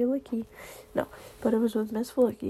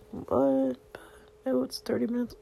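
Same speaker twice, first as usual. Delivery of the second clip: normal talking voice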